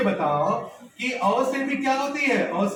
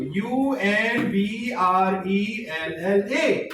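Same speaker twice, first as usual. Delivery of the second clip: slow letter by letter: यू एन बी आर ई एल एल ए; spelled out slowly; 2 semitones lower, much slower than usual. Shown as a demonstration. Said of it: "आप क्या बोलते हो". (धीरे-धीरे अक्षर-अक्षर बोलना)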